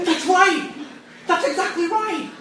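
high-pitched, acted outburst of agreement; emphatic agreement. Student agreeing he wants God to shut up, emphatic outburst. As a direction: exclaim it high, as a sudden breakthrough.

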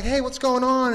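high, loud answering hail; hammed-up reunion enthusiasm; excited greeting. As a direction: act out greeting an old friend. Acted reunion with long-lost friend.

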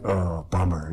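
low, deflated mumbled reaction; mildly sulky, dropping low; mock letdown. Priest hearing his buddy's event went amazing, mock disappointed. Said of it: "I'm like".

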